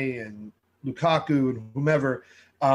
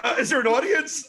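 laughing question; 9 semitones higher than usual. Real, not acted. Joking at self-congratulation.

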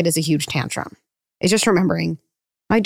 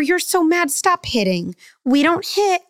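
mocking flustered parent; 10 semitones higher, slower than usual. Parent scolding ineffectually.

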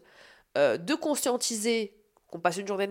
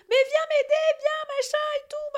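frantic high-pitched panic, calling Raph; panicked shrieking. Retold or imitated. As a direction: shriek for help as the water floods. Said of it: "Je crie de colère".

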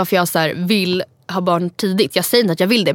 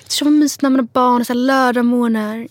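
a lightly voiced dreamy boyfriend, a touch higher; 3 semitones higher than usual. Girl imitating her boyfriend dreaming of cozy Saturday mornings, lightly mocking. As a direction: mimic his starry-eyed take on weekends. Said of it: "och Oscar också så här".

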